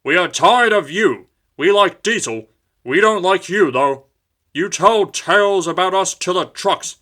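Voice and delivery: loud, high angry engines. A narrator's reading. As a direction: scold him loudly. Engines quarrel with Duck.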